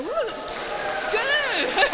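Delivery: high, loud cheer; whooping. Shown as a demonstration. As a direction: lead the whoop for the graduate. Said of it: "If there isn't enough whooping".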